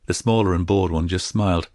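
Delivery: plain reading voice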